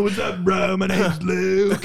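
slow, dopey bro impression with stretched words; mock a co-host as a drawling bro; Luke. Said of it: "I don't think that's how I talk".

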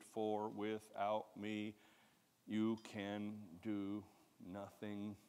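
slow, soft weighty words; slow each word down to land it; slow, quiet scripture close; slow emphatic scripture. Acted. Slowed scripture quote.

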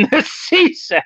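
high, drawn-out words broken up by real laughter; helpless laugh through the words. Real, not acted. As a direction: crack up while finishing the sentence. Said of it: "Sorry, I'm laughing here".

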